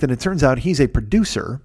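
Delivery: ordinary delivery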